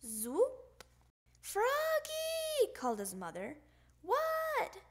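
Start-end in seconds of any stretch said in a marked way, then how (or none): 1.4-2.7 calling out. Acted. Mother calling across the house. 4.0-4.8 put-on child's answer. Froggy answering mother's call.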